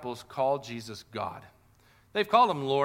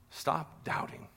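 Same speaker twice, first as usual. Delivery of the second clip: breathy whispered urging; 7 dB quieter, slower than usual. Retold or imitated. Jesus whispering to Thomas, tender.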